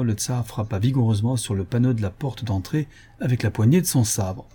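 ordinary delivery

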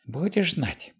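put-on high scolding old woman voice; angry old woman. A narrator's reading. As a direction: scold like a furious old lady.

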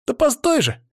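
put-on very high-pitched insistent boy; boyish voice. A narrator's reading. Boy chasing the man down, high boyish call. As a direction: pipe up high, urging him to stop. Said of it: "знакомый мальчишеский голос".

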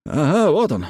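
mildly excited exclamation; exclaiming. A narrator's reading. Vet hearing the radio come alive at last, exclaiming. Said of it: "воскликнул Хардинг".

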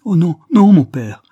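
loud, high cry of horror; pleading, panicked outburst; desperate protest. Acted. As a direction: cry out to stop him. Daughter begs in horror.

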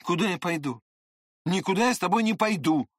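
high, quickening refusal; defiant cry. A narrator's reading. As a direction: fire back a refusal as a challenge. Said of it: "поспешил я крикнуть с вызовом".

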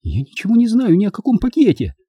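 high-pitched tearful denial; through tears. A narrator's reading. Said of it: "заговорила Луиза сквозь слёзы".

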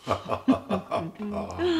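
high, soft laugh; a happy, gentle laugh; light laugh. Acted. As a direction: laugh with relief. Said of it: "er lacht".

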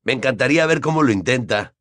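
ordinary delivery

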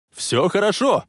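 high, reassuring call; encouraging shout. A narrator's reading. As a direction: call out to hearten someone. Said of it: "ободряюще кричал он". (ободряющий крик)

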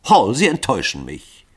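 angry disappointment. A narrator's reading. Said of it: "rief Lycoville zornig".

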